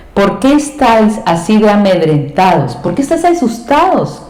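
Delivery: high, pressing rebuke of frightened followers; Jesus rebuking. Acted. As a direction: scold them, lifting the voice. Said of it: "te dice el Señor". (Jesús reprendiendo con voz alta e insistente)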